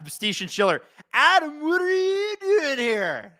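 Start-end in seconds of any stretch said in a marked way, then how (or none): 1.1-3.3 much higher, slow, drawn-out SoCal whine; hammed-up Californians-skit shocked cry; Californians skit accent. Quoting a viewer as a dramatic Californians-skit character in a SoCal accent. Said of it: "The Californians is such an elite skit".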